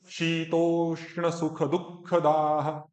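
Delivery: शीतोष्ण सुख दुख दाह said slowly, each syllable clear, दाह with plain द; slow careful recitation of शीतोष्ण सुख दुख दाह; model pronunciation. Shown as a demonstration. Teacher models the verse slowly for student, careful recitation. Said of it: "मेरा जो शुद्ध उच्चारण".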